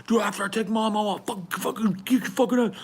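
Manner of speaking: high, rushed heated threat; threatening. Retold or imitated. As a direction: spit out a promise of revenge. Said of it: "Scotty goes".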